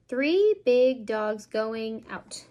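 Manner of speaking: slow, lilting picture-book read; reading to children. Shown as a demonstration. Parent reading a counting book to a toddler, slow and sing-song. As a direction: draw the little listener into each page.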